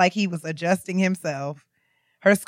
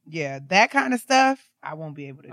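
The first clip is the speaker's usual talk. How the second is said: usual speaking voice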